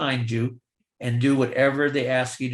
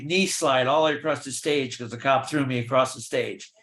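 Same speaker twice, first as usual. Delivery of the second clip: ordinary talk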